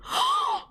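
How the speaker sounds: high, sudden gasp of shock; sharp shocked gasp. Real, not acted. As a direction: catch your breath in horror. Sees the Grim Reaper take Rosa.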